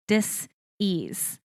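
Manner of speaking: "dis-ease" with a clear pause before "ease"; two separate slow beats on "dis-ease"; split into two words. Shown as a demonstration. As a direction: spell out the break in "dis-ease", dis, then ease. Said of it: "space E A S E".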